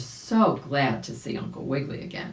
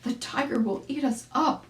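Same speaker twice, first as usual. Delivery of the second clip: a lightly voiced scared boy, higher; frightened boy; 10 semitones higher than usual. Boy warning his pal of the tiger, higher scared voice. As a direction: cry out the danger to your friend.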